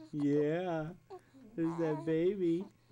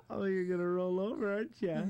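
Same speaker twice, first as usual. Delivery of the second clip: ordinary talk, nothing marked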